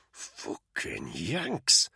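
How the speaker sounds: slow, low, bitter hiss. Acted. Soldier disgusted by the Americans, hissing slowly. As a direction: spit the curse out slowly. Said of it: "Spud hissed".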